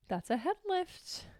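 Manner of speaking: gasp, then soft, slow and amazed; hushed mock amazement; mock delighted gasp. Real, not acted. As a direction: marvel at the dog lifting its head.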